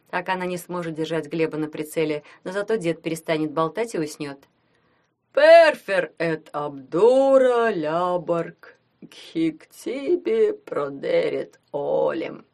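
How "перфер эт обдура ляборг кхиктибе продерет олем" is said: very slow, drawn-out Latin with a big yawn; Феофил Гроттер, yawning. A narrator's reading. Sleepy ring intones Latin from a pocket, yawning. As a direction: stretch every word through a loud yawn. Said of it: "Донёсся из кармана зевающий голос Феофила".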